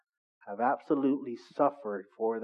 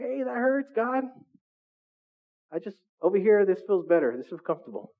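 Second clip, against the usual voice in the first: whiny complaint; 6 semitones higher than usual. Pretend. Voices person resisting God's pruning.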